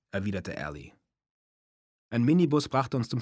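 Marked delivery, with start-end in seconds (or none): none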